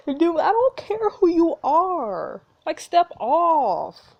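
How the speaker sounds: loud, high and slow telling-off; fed up, drag the words out loudly; slow, forceful back-off warning; exasperated outburst. Real, not acted. Player fed up with pushy duke.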